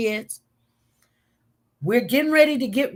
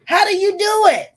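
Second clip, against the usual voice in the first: high, loud, astonished question; imitating a stunned mom, way up high; amazed; 9 semitones higher, 8 dB louder than usual. Mother amazed to find her son asleep, high and loud.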